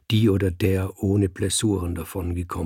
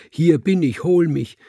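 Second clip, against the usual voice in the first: higher, pleading call to death; retold cry; 8 semitones higher, 3 dB louder than usual. Recalling his plea to death, raised calling voice. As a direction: call out to death, still raised but fading. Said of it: "schrie ich".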